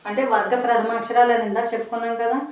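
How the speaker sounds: usual speaking voice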